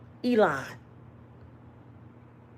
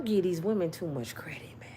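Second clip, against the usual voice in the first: softer whispered aside; 5 semitones lower, 10 dB quieter than usual. Real, not acted. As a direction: lean in and whisper it. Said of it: "aside to audience".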